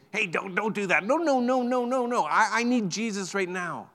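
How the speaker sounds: the blind man refusing to be hushed; retold blind man. Blind man pushes back at crowd.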